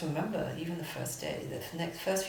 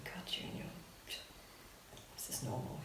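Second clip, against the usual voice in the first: hushed comfort; 4 semitones lower, 17 dB quieter than usual. Retold or imitated. Visitors' hushed comfort retold.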